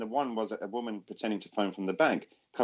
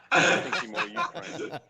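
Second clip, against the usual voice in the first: laughing; 7 semitones higher than usual. Real, not acted. Joking aside about scammer stereotypes.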